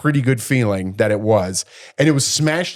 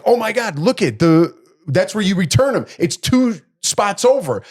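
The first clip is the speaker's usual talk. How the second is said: higher-pitched retold "can't believe it" outburst; indignant disbelief; 8 semitones higher than usual. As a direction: point it out in exasperated amazement. Retelling indignant outburst in car.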